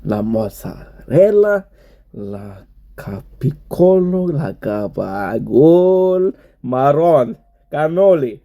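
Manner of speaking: hammed-up Neapolitan-American accent, stretching gabagol; a playful Italian-American food roll call; mock Italian accent. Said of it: "pronounce prosciutto with a Napolitan accent".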